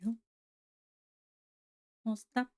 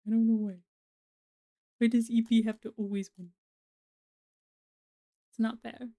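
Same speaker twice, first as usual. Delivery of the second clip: whiny complaint; 6 dB louder than usual. Real, not acted. Whiny complaint about unfairness.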